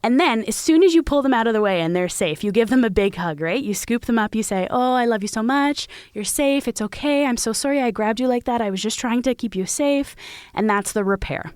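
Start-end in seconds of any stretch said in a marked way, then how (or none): 4.7-10.1 tender mock comfort to a toddler; soothing parent. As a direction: reassure a scared child. Parent voicing comfort to child.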